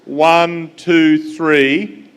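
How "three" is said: normal talking voice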